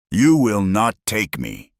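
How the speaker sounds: villain screaming at the crowd, hammed-up lightly; screamed. A narrator's reading. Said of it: "he screamed".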